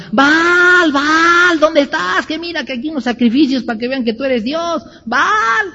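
high, desperate mock cries to a silent god; mock crying out. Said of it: "Los profetas de Baal gritaban".

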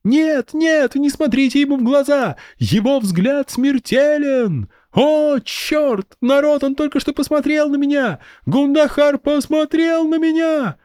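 put-on high kid hamming a game death; playacting child. A narrator's reading. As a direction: overplay it like a kid's game. Child plays petrified victim.

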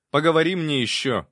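a policeman yelling at a cheeky prisoner; sharp shout. A narrator's reading. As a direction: cut the prisoner off. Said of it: "прикрикнул пристав".

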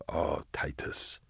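whispered, slow and sorrowful under the breath; muttered. A narrator's reading. Leibstrom pitying his confused friend, a slow muttered whisper. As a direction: breathe it out in pity, barely voiced. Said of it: "Leibstrom muttered".

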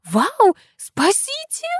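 high-pitched acted yelp of alarm; cry for help. A narrator's reading. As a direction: squeal it out like a startled girl. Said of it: "изнутри ржавой кабины полетел крик". (испуганный крик о помощи)

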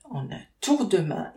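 ordinary delivery

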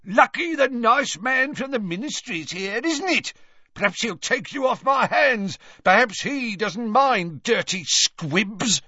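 high-pitched, taunting jeer; mock her with scorn, voice pitched high. A narrator's reading. Father jeering at his daughter before a visitor. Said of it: "he jeered at his daughter".

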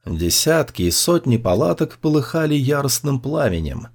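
usual speaking voice

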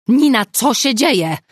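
loud, high alarmed call. A narrator's reading. Agata bursting into the bedroom, calling out loud. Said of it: "zawołała Agata".

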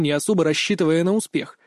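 ordinary delivery, nothing marked

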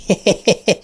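high, mocking clown giggle. A narrator's reading. Clown laughing at Ben from above, high giggle.

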